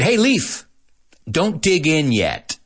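high call of "Hey, Leif" then slow teasing. Retold or imitated. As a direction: tease the actor across the room. Stagehand calls out teasing.

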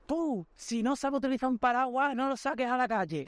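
high, scolding yell imitating his girlfriend; retold angry yell. Girlfriend scolding the umbrella man, mock angry yell. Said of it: "Le metió un grito".

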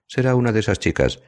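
high-pitched, cheerful remark. A narrator's reading. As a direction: say it lightly and happily. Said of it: "dice Van Patten alegre".

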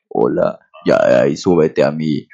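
very low, slow seductive voice; sultry TikToker. Retold or imitated. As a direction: drawl like a self-loving flirt. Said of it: "como el TikToker que se cree guapo".

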